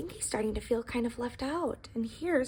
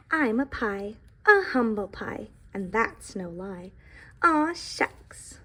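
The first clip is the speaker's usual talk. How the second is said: put-on reading to children; 5 dB louder, slower than usual. A narrator's reading. Reads the pie's lines.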